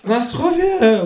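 high, drawn-out cheer; high-pitched celebratory cry; called-out toast. A narrator's reading. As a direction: call out a toast as the kangaroo. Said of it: "ruft das Känguru".

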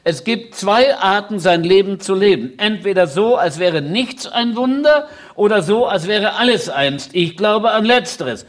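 emphatic public quotation; quoted maxim. Retold or imitated. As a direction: proclaim the saying emphatically, measured and loud. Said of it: "der hat einmal gesagt".

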